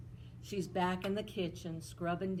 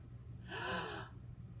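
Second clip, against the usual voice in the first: sharp delighted gasp; amazed gasp; 10 dB quieter than usual. Acted. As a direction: gasp at the slippers. Amazed at slippers.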